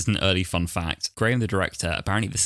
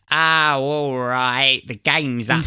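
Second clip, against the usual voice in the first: louder, higher mock Cockney drop from posh; rough Cockney switch; 6 semitones higher, 6 dB louder, much slower than usual. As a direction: caught out, dropping into a common accent. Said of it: "from their refined English voice to".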